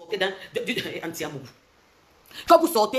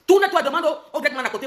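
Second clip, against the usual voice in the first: raised, higher order; loud command; 6 semitones higher, 5 dB louder than usual. Real, not acted. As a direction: bark the order up high. Heated command to a rival.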